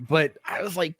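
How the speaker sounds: normal talking voice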